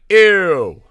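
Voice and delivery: loud, put-on "Ew." of disgust; a hammed-up recoil of disgust; mock disgusted ew. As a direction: spit it out like you smelled something. Disgusted retort in retold scene.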